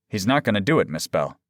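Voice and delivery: usual speaking voice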